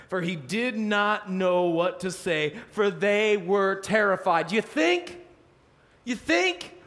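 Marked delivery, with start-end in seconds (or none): 4.5-6.7 much higher sarcastic question; mock the obvious; incredulous rhetorical jab. Real, not acted.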